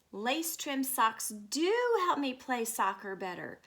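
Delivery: usual speaking voice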